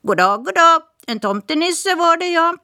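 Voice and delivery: high, quick parrot voice; chatty parrot. Acted. Parrot introducing herself to Smirke, high bird voice. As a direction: rattle it off like a talkative bird. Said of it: "sa Polly".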